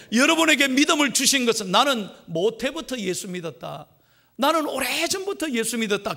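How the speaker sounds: high, loud fervent preaching; preaching at full force. Acted. Pastor urging believers to share the gospel, full preaching voice. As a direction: lift the congregation with a ringing call. Climax of the sermon exhortation.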